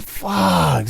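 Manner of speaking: a high, drawn-out dismayed fuck; dismayed curse. Retold or imitated. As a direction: groan it out at the mess. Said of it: "So we're like".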